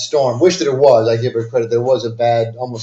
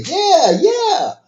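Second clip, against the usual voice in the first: put-on very high, eager girl's yeah; girlish voice; 4 dB louder than usual. Scammer girl eagerly confirming to the man, high girlish voice. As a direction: squeak it like a keen young woman.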